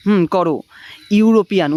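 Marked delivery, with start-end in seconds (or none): 1.2-1.7 ইউরোপিয়ান spoken crisply, starting on a "you" sound; pronunciation demo. Shown as a demonstration. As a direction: model ইউরোপিয়ান for the class to repeat, loud and clear. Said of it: "এই উচ্চারণটা আমার সঙ্গে সঙ্গে করো".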